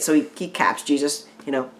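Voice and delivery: normal talking voice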